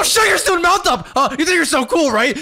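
high, heated outburst; jealous anger. Acted. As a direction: lash out at a rival. Confronts the rival boyfriend.

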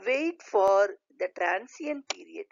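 usual speaking voice

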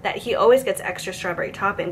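ordinary delivery

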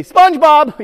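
loud, high silly answer; joke answer. Shown as a demonstration. Presenter shouting a silly answer to the kids, loud and high. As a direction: sell the joke big and high. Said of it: "Everybody say".

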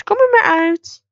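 bright high call to come out; high coaxing call. Acted. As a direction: sing it out high to the horse. Calling horse again, higher.

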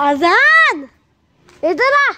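loud, high call across the house. Real, not acted. Calling a kid named Ajan. (ज़ोर से आवाज़ देकर बुलाना)

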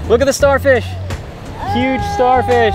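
real thrill at spotting a starfish; high-pitched excited shout of discovery. Real, not acted.

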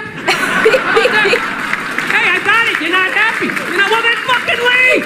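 high, mocking husband snap; hammed-up grumpy husband; sarcastic old husband. Old husband snapping at wife.

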